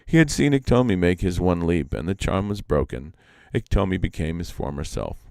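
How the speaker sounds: ordinary delivery, nothing marked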